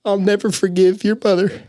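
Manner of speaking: high, tearful voice; real crying. Real, not acted. Storyteller recounting grandma's words about his mother, breaking down crying. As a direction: push the words out through tears.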